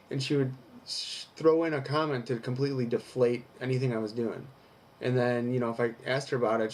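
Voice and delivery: usual speaking voice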